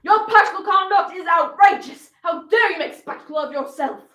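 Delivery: indignant parental scolding; livid father. Acted. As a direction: thunder at a disgraced daughter. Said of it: "He was livid".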